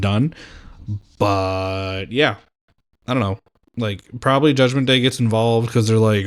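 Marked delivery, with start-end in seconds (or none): none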